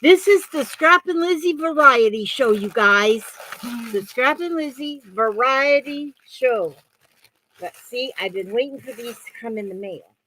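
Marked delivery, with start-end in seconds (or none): 0.0-3.2 loud, high, proclaiming show title; show announcer. Real, not acted. Announces her show's name. 3.9-6.8 high, slow, drawn-out title; show announcer. Real, not acted.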